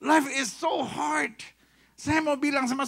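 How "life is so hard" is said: mock lament. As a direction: wail the complaint slowly. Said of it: "komplain sama saya dan bilang".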